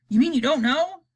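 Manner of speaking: high, loud disbelieving voice; can't believe it; incredulous president. Acted. President presses the chief spy, loud and disbelieving. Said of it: "president asks spy".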